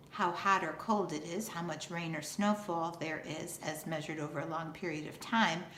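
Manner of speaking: ordinary talk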